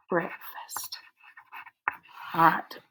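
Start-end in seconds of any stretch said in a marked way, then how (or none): 0.1-1.0 soft blended word. Shown as a demonstration. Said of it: "watch me with breakfast".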